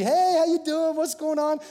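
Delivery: high, loud, bright hello; cheery greeting. Retold or imitated. Preacher imitating how he greets coworkers, high and chirpy.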